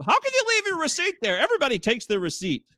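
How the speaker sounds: high-pitched mock disbelief; exaggerated incredulous squawk, easing at the end; appalled, obsessed employee. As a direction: imitate someone utterly aghast at you. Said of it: "she was obsessed with".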